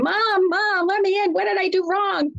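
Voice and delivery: high, pleading pet-cat voice; Harry. Retold or imitated. Owner voices her cat crying in the hallway, high pleading wail. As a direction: wail it like a locked-out pet begging mom. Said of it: "I couldn't listen to him cry".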